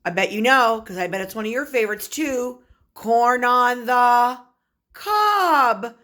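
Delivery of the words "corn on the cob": savoring corn on the cob; slow drawn out. Shown as a demonstration. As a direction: stretch it out with delight. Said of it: "teacher savoring favorite food".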